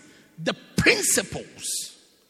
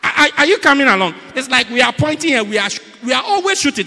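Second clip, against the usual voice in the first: loud, raised-pitch sermon shout; full-force preaching; 7 semitones higher, 11 dB louder than usual. Real, not acted. Preacher warning congregation about the line of fire, full-force preaching. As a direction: drive the warning home at full volume.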